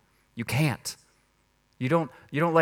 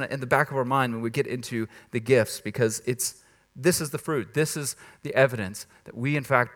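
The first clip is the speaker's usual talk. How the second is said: usual speaking voice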